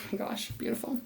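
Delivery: breathless whisper of wonder; awed whisper. Real, not acted. Reader whispers in awe at the card. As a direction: hush it out, amazed.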